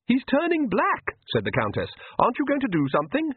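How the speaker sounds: a touch of fussy alarm; lightly alarmed Countess. A narrator's reading.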